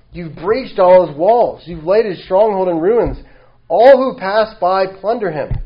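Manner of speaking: loud, slow lament over the ruined king; impassioned reading. Acted. Preacher reading the psalmist's lament, loud. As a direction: read the ruins out with force.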